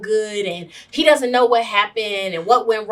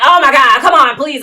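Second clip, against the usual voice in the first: mock exasperation; 5 semitones higher, 10 dB louder than usual. Imitating his exasperated reply.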